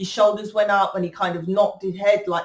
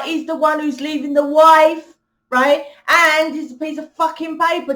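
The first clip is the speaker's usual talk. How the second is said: loud, fed-up comeback, higher pitched; exasperated loud rant about marriage; indignant retort; 5 semitones higher, 5 dB louder than usual. Retold or imitated. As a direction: snap back at the critics with scorn. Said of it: "I'm like".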